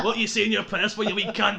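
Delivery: loud, laughing Glasgow-style jibe; mock Scottish. Mock Scottish banter after accent talk.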